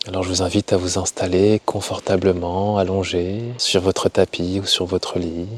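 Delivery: slow, soft guiding voice with long pauses; guided meditation. Acted. Yoga teacher settling listeners for Yoga Nidra, slow calm meditation voice. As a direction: ease them gently into rest, unhurried and hushed.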